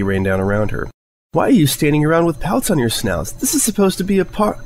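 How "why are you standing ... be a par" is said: high, bouncy party cheer; squeaky party pony glee; bubbly excitement. Acted. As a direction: burst in all excited. Pinkie bursts in with confetti.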